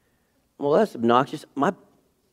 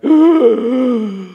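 high, slow gasp of alarm; shocked gasp; 12 semitones higher, 9 dB louder than usual. Retold or imitated. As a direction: gasp as if you just saw her fall. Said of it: "I'm like".

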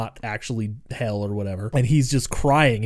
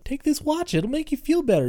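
mock little creature voice; 13 semitones higher than usual. Mudlap offers Ryan a watch.

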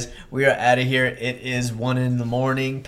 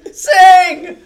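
high, loud laughing yell; shouting for a song through laughter; 13 dB louder than usual. Real, not acted. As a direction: cheer the other on to sing.

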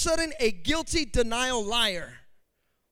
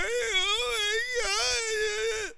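high, slow wailing whine; mock child crying; 10 semitones higher, much slower than usual. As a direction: imitate a little girl bursting into tears. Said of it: "she starts crying".

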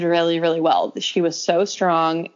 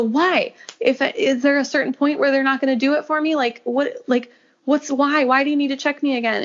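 frantic questioning; 7 semitones higher than usual. Retold or imitated. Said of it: "you're acting like a crazy person".

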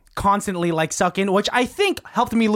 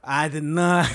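Bill Clinton impression; 3 semitones lower, slower than usual. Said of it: "Bill Clinton, go".

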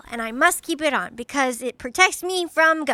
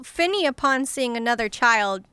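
ordinary delivery

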